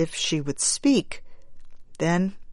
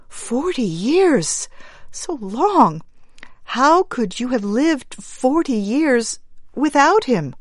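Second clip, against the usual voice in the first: dismayed; 7 semitones higher than usual. Acted. Mother astonished, grieving.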